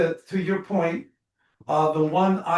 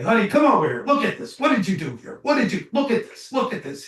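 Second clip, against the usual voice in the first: much higher, pushy nagging husband; critical husband; 6 semitones higher, faster than usual. Retold or imitated. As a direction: scold your wife over her mistake. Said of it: "he'd call his wife".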